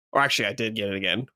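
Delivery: ordinary talk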